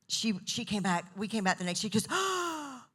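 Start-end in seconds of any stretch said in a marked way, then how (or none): 2.1-2.8 high, drawn-out breathy "ah"; overwhelmed gasp. Retold or imitated. Greeting them next day about the song, overwhelmed gasp. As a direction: imitate a swooning gasp.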